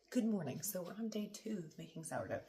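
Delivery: ordinary delivery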